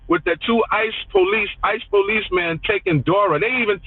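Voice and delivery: usual speaking voice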